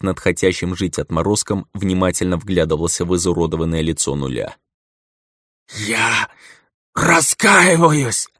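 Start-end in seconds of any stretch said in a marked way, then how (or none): 5.9-8.3 strained plea. A narrator's reading. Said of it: "с надрывом прошептал".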